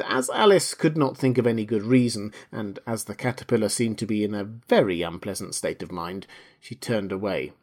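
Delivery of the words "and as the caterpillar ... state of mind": usual speaking voice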